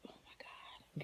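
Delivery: a breathless whisper to herself; whispered oh my God. Retold or imitated. Feeling ill on a date, she whispers in panic. Panicking inwardly at a rooftop bar.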